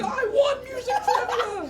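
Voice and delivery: high, slow squealing victory cry; freaking out. Retold or imitated. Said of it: "she starts freaking out".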